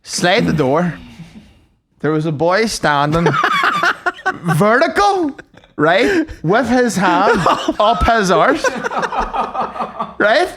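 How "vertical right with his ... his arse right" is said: high, laughing through the words; cracking up. Real, not acted. Comic cracking up at his own toilet story, laughing. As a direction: struggle to tell it through laughter.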